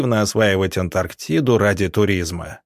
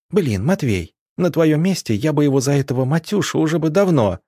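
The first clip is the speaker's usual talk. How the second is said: higher-pitched, needling threat; mock threat; 6 semitones higher than usual. A narrator's reading. Арина warning the big man over Матвей's nickname, threatening. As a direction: threaten him on a friend's behalf, pitched up. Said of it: "погрозила здоровяку Арина".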